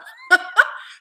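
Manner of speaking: high, loud delighted laugh; squealing laugh. Real, not acted. After telling of the donor, a squealing laugh. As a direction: burst into giddy laughter.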